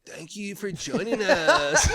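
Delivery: slow, high sign-off voice; newscaster voice. Acted. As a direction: announce it slowly like a host. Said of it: "Just like that".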